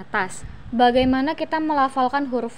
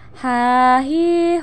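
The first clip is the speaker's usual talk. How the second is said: slow drawn-out recitation drill; chanted model for pupils; swung syllables; slower than usual. Shown as a demonstration. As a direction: stretch each vowel evenly. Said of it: "ayu ayun mana tahan".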